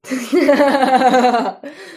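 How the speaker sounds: loud guffawing belly laugh; hearty, booming story-bear laughter; bear's laugh. A narrator's reading. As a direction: laugh uproariously as the amused bear. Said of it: "Smál se medvěd".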